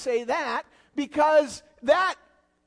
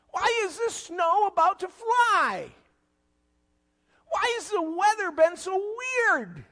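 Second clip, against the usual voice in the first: searching cries; 7 semitones higher than usual. Retold or imitated. Preacher voicing people crying out why they exist. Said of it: "you have to ask the question".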